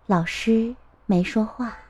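ordinary delivery, nothing marked

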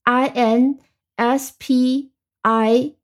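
slow dictation of each separate letter; spelling out. Shown as a demonstration. Teacher spells inspiration for learners, spelling out slowly. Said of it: "注意拼写".

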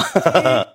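short burst of real laughter, falling; a quick real chuckle. Real, not acted. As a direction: laugh at your own story. Laughs at own story.

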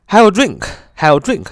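slow, careful have a drink; slow model phrase. Shown as a demonstration. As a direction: model it slowly for students. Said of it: "比如说".

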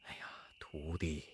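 hushed, low whisper to a disciple; very soft, secretive aside; quiet whisper. Acted. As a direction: whisper it under your breath, anxiously discreet. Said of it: "悄悄地说".

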